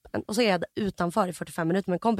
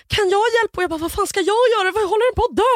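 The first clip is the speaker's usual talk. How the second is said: high, loud frantic questions; hammed-up terror, pitched high; terrified; 10 semitones higher, 4 dB louder, faster than usual. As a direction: act out yourself panicking in bed. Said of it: "jag är så fucking rädd".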